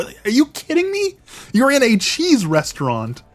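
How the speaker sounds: high, incredulous retort, pitch climbing; exasperated disbelief. Retold or imitated. Vlogger's imagined retort to the lady, incredulous. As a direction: scoff at her in disbelief.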